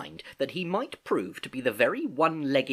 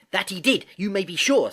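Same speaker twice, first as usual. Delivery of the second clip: higher, louder confirmation; eager boy; 8 semitones higher, 5 dB louder than usual. Acted. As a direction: jump in with keen certainty.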